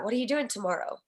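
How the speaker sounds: ordinary talk, nothing marked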